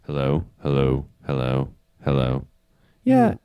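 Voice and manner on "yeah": startled, high and loud; surprised. Real, not acted. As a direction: caught off guard, voice jumps up. Hears demon voice effect.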